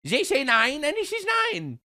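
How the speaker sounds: high, fawning German caricature; hammed-up submissive German voice; meek mock German. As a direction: grovel as a squeaky cartoon German. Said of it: "And they're like".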